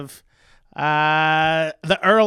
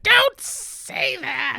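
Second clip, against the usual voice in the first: high, drawn-out mock protest; mock distress; 13 semitones higher, much slower than usual. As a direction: wail it slowly, far above your usual pitch. Acting out cringing female listeners' reaction.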